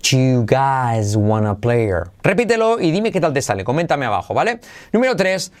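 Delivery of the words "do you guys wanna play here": slow model. Shown as a demonstration. Slow model of the drilled phrase.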